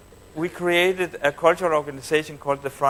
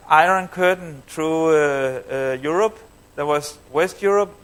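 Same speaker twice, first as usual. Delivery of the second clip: ordinary talk, nothing marked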